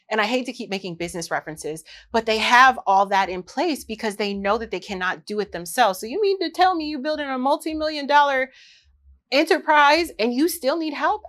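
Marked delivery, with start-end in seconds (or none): 6.0-11.2 high-pitched mock disbelief; raised, challenging tone of disbelief; incredulous question. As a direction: question them as if astonished.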